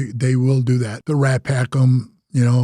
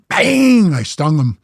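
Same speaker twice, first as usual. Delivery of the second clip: ordinary delivery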